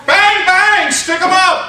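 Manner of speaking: high, loud stick-up shout; full shout demo. Shown as a demonstration. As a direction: yell it like a holdup. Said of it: "Can we try that again?".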